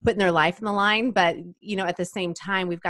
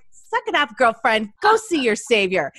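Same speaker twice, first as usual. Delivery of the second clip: louder, higher teasing tough love; mock scolding; 10 semitones higher, 7 dB louder than usual. Scolding drama queen Mary, loud teasing exasperation. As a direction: tell her off with a grin. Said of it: "And I'm like".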